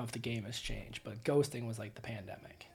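dropped to an unvoiced whisper; hushed whispered tag. Real, not acted. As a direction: breathe it out as an aside.

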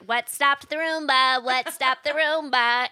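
loud exasperated call. Retold or imitated. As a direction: call it out, fed up. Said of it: "I'm like".

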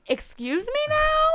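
high, slow, disbelieving squeak; stunned mock outrage; incredulous. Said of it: "And I was like".

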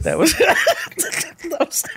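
words broken by laughter; laughing. Real, not acted. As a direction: crack up mid sentence. Laughing breaking up.

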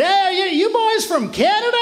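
a bar regular in Atlanta, playing up the twang; Southern barfly voice. Bar regular quizzing Canadians, high Southern twang. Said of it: "And he said".

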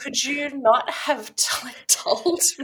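high, loud, laughing disbelief; mock exasperation. Retelling her comeback to her cousin, exasperated and laughing. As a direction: protest in comic outrage, breaking into a laugh.

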